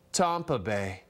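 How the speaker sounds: a low, stretched, snooty "Tampa Bay" with a broad ah; posh Thurston Howell. Shown as a demonstration. Host mocking Tom Brady's new catchphrase, low snooty drawl. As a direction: drawl "Tampa Bay" like a yacht-club snob. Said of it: "or should I say".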